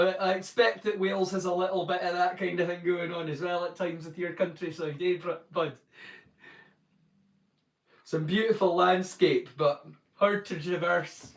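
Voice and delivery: ordinary talk, nothing marked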